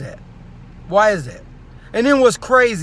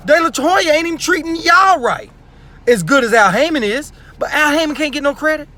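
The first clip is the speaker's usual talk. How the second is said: much higher and louder, indignant rant; heated outburst; 6 semitones higher, 4 dB louder than usual. Real, not acted. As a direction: call them out, voice rising in outrage.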